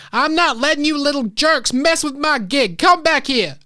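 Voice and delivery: put-on high, loud officer yelling after fleeing kids; angry shout. Truancy officer Zara chasing the kids, shouting angrily. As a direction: bellow it mid-chase, furious. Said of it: "you hear her shouting behind you".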